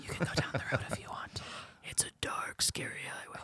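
whispered mock-scary warning; whispering about a dark scary highway; spooky whisper. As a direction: hush it like a ghost story. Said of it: "Why are we whispering?".

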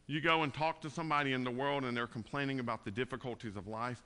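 ordinary talk

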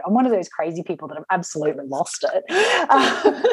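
fast, laughing through the words. Retold or imitated. Cartwheeling down the beach, laughing at herself. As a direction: giggle it out quickly.

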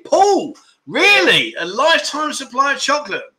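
a playful "really?" at the viewer's choice; surprised disbelief. Real, not acted. Teasing a viewer for picking chocolate, in mock disbelief.

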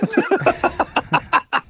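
sudden high-pitched laugh. Real, not acted. Laughs after Forrest Gump line.

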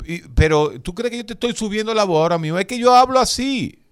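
loud, high, fast defensive insistence; mock loud defensiveness. Playing the loud friend denying he yells, mock loud defense. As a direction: raise your voice while denying you're shouting. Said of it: "y usted le pregunta".

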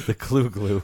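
chuckling through the pun; laughing. Real, not acted. Amused at co-host's Clue glue pun, laughing. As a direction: repeat it while still laughing.

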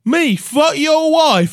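high, loud, drawn-out mock British; British accent. Denying it to the guv, hammed-up British indignation. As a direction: shout slowly in a silly British accent. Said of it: "Can you do a British accent?".

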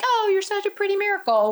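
high, gushing mock sweetness, imitating a church lady. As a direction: coo it like a doting lady at church. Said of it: "be like".

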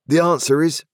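a plain man, firmly offended; indignant Joe. A narrator's reading. Joe answers.

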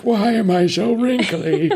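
very high, slow, withered whine; mock-pitiful, way up high; whiny drained victim. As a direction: whimper it like you've been drained dry. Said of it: "You're like".

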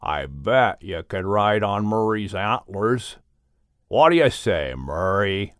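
a big friendly bear talking low and easy. Acted.

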